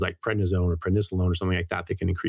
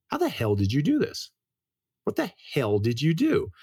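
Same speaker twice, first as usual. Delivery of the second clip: shocked disbelief; 5 semitones higher than usual. Retold or imitated. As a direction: ask it amazed, a bit higher. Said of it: "her doctor was shocked".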